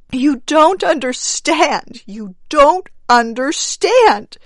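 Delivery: high, loud, slow desperate plea; panicked frantic pleading. Acted. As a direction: plead frantically, near breaking. Wife frantic, repeats herself.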